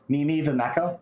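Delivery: usual speaking voice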